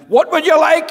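high, loud bellow; bellowing grocer. Acted. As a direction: boom it at the customer. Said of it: "when the grocer bellowed at him".

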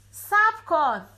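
put-on little girl calling from far off; from far away. A narrator's reading. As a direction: call it from the back of the house. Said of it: "صدای دختر از دور گفت".

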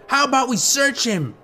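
put-on high-pitched street kid voice; kid in gang. A narrator's reading. One kid to the gang about Link, high suggestion. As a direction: pipe up as an eager young gang member. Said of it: "one kid suggested".

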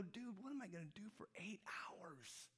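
high, hushed, strained disbelief; a mock whine of dismay, high and soft; dismayed protest. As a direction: plead with your pal in a small high voice. Acting out his dismayed reply.

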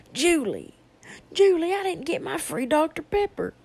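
loud, high whine calling for Julie; demanding customer. Retold or imitated. Customer calling Julie over the missing Doctor Pepper, loud and high. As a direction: whine up at the manager by name.

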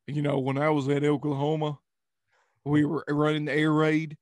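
a hint of an Oklahoma Appalachian drawl; lightly mock the coach's Oklahoma drawl. Imitating the coach, light Oklahoma drawl. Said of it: "Oklahoma ... Appalachian accent kinda mix".

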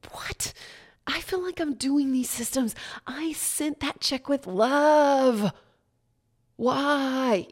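wailing why at the sky; mock anguish. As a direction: cry out to the universe in disbelief. Distressed retelling of her breakdown.

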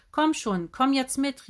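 verzweifeltes Rufen. A narrator's reading. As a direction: plead loudly, a touch desperate. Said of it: "rief Dennis verzweifelt". (verzweifelt gerufen)

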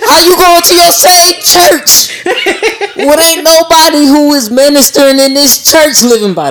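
impassioned shouting. Real, not acted. Host calling out hypocritical churchgoers, high impassioned shout. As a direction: holler it out, stretching the words. Heated challenge to churchgoers, building rant.

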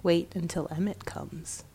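soft, ominous cat voice; quietly. A narrator's reading. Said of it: "said quietly".

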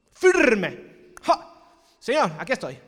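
loud, defiant cry of firmness; shouted declaration. Acted. Believer declaring to God he stays firm, loud shout. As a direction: plant your feet and shout it.

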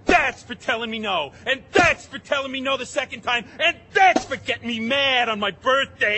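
loud, rising rage with each blow; furious and relishing it; angry shout. Acted. Robber hitting with shovel.

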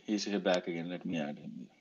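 usual speaking voice

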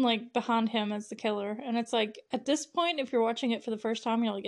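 normal talking voice

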